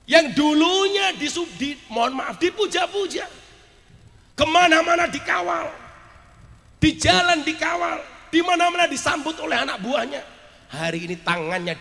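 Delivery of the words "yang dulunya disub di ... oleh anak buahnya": high, emphatic, mounting list; impassioned preaching. Real, not acted. Preacher recounting a minister's former glory, impassioned preaching. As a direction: hammer out each phrase with raised voice.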